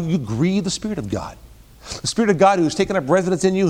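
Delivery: normal talking voice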